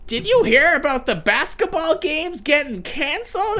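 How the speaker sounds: put-on high, slow old-man holler, off mic; drawn-out, high-pitched yell from back off the mic; mock shouting. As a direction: imitate a half-deaf man shouting at someone. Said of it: "I'm gonna shout it".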